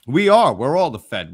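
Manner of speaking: loud boast. Real, not acted. Rallying Fed boast.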